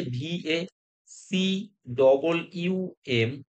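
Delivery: slow, letter-by-letter spelling. Shown as a demonstration. Teacher giving students the correct spelling, spelled out slowly.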